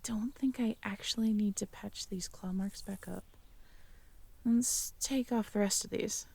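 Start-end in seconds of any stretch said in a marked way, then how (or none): 0.0-3.2 soft unvoiced whisper. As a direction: murmur it under your breath while tending wounds. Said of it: "unvoiced whisper, roleplay ASMR".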